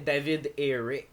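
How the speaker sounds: put-on French names said in an English accent. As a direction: say the names like an anglophone. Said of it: "Pourquoi tu le dis avec un accent anglais?".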